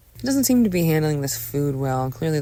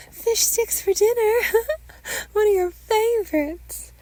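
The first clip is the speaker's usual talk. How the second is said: very high, slow singsong mother voice; forced-cheery mother; 4 dB louder, slower than usual. Acted. Mother changes subject to dinner for son, high forced cheer. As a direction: chirp it slowly, covering the emotion.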